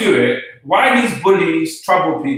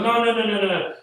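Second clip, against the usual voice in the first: mimicked dismissal; 5 semitones higher, 3 dB quieter, faster than usual. Mimicking the big man's denial.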